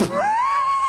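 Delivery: a very high short laugh; high yelp of laughter. Real, not acted. Streamer laughing while correcting a viewer's history. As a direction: burst out laughing.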